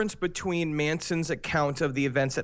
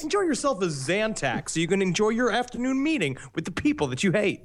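swooping, over-keen commercial read; put-on pitchman patter; spoof ad pitchman; 5 semitones higher than usual. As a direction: sell it like a cheesy TV spokesman.

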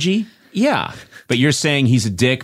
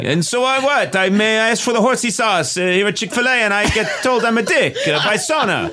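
high, laughing, hammy fake Italian; bad Michelangelo impression; 11 semitones higher than usual. Michelangelo defends himself at Chick-fil-A in a hammy Italian accent. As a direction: play Michelangelo with a silly high Italian voice, chuckling. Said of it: "the worst Michelangelo impression".

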